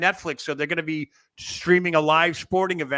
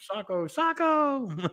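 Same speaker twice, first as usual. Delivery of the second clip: high, drawn-out squeal; slow squeaky catchphrase call; Mankind's Socko call; 10 semitones higher, 4 dB quieter, much slower than usual. Retold or imitated. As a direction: stretch it out in a high put-on voice. Imitating Mankind presenting Socko.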